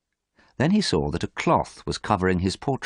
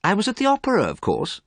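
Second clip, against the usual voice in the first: higher, dismissive bored tone; 3 dB louder than usual. Acted. Dorian tells Basil where he was, bored. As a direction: shrug the words off. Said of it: "Dorian sounded very bored".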